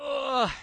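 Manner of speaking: mock a grossed-out reaction; disgusted groan. Disgust at juices pouring from meat.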